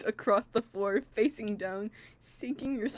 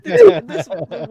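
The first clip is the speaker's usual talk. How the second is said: loud, high through laughter; laughing; 6 semitones higher, 15 dB louder, slower than usual. Real, not acted. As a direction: choke on laughter. Laughing too hard to speak.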